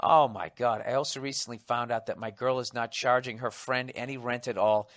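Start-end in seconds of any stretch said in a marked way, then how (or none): none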